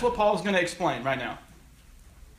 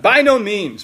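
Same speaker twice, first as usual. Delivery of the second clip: "By no means" said louder and firmly; emphatic denial; 3 semitones higher, 7 dB louder than usual. Acted. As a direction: punch "By no means" as a brief exclamation. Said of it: "It is an emphatic denial".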